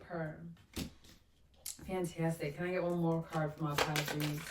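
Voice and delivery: normal talking voice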